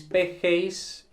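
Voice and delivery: "P G Is" drawn out letter by letter; slow and deliberate. Shown as a demonstration. Teacher repeats the form slowly for students. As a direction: stretch out "P G Is" for the class. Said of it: "Luego P G Is".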